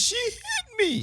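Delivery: high, drawn-out mock wail; mock crying. Playing a woman tearfully accusing someone to police, mock crying. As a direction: whimper it softly, way up high. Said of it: "start crying and try to tell the police".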